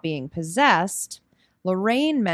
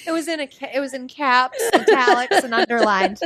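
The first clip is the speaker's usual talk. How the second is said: higher-pitched, giggly delivery; real laughing; 6 semitones higher, 4 dB louder than usual. Real, not acted. Describing the co-host's written punchline, laughing. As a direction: list it through helpless giggles.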